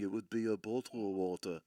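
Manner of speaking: low Liverpool accent, bottle of water. Shown as a demonstration. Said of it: "a Liverpool type thing".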